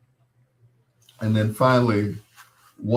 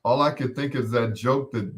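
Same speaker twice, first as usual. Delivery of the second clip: normal talking voice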